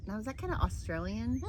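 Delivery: usual speaking voice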